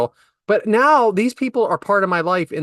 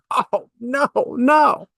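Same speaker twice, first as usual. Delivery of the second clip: mock panic; 6 semitones higher, much slower than usual. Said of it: "I'm like".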